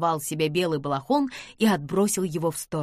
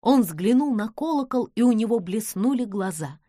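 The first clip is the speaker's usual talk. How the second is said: ordinary delivery, nothing marked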